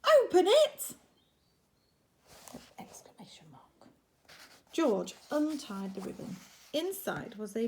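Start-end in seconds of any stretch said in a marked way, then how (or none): 0.0-1.0 very high loud urging; eager shout. Acted. Animals begging George to open the present, high and loud. 2.8-3.9 whispered, much softer; whispered aside. Acted. Reader whispering the mark to the children.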